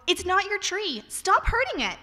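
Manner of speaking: put-on high, loud yell at a tree-hurting kid; child's yell. Poet reading her childhood poem yells at a boy, high and loud. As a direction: shout it, scandalised and furious. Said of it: "I yelled".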